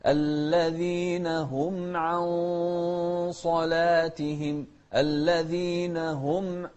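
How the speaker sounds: slow, sung recitation; slow sung Quran recitation; melodic Quran recitation. Shown as a demonstration. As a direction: chant it slowly and melodically.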